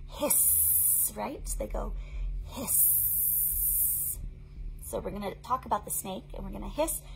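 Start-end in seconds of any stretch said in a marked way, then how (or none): 0.1-1.1 whispered hiss; soft sustained snake hiss. Acted. 2.5-4.2 whispered hiss; soft sustained snake hiss. Acted.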